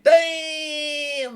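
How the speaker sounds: sudden high shouted "Damn!"; imagined wow. Pretend. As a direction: blurt it out like an amazed viewer. Viewer's imagined excited reaction.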